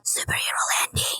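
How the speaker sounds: hushed, voiceless aside; whisper. Acted. Mando lands after the crash, whispered aside.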